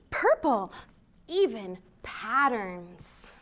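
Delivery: lively, expressive picture-book reading; reading to children. Acted. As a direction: read it like storytime for little ones. Reads picture book aloud.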